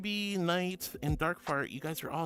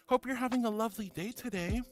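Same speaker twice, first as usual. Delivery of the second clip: usual speaking voice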